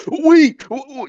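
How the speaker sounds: high, sharp mock shout; retold yell. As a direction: call out high like a panicked meathead. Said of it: "yelled out to her".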